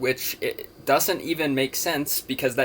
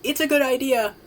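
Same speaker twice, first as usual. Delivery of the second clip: mock high voice; 13 semitones higher than usual. Repeated approving aside, pitched way up.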